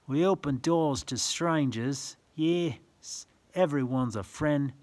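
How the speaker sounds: sing-song rhyming read-aloud; reading verse to children. Acted. Reading a picture book to a child, sing-song. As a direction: lilt the rhyme for little listeners.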